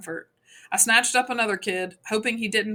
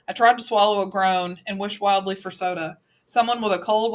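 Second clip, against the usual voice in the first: ordinary delivery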